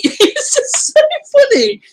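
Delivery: high, loud laughing through words; breaking into laughter. Real, not acted. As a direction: crack up while saying it. Laughing hard retelling.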